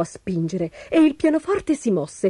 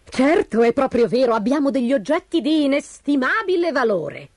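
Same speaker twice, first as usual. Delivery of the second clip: crafty, higher-pitched boasting wife; sly; 6 semitones higher than usual. Acted. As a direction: confirm it with cunning relish. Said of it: "confermò furbescamente". (tono furbesco)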